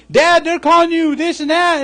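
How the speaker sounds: put-on a kid running home to tell dad; upset child. Noah's children come home upset.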